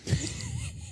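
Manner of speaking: high, breathy dirty cackle; dirty laugh. Real, not acted. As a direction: let out a dirty snicker. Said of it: "Was lachst du so dreckig".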